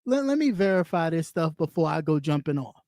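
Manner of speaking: low, measured inner voice; voice of caution. Acted. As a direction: quote a sensible person, pitched well down. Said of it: "a better sense of".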